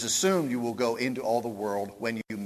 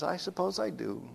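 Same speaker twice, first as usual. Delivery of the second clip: a touch of Golda; 6 semitones higher, 5 dB quieter than usual. Retold or imitated. Golda admits.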